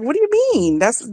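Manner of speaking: high, puzzled retort; baffled high question. Retold or imitated. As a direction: voice jumps way up in confusion. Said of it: "I was like".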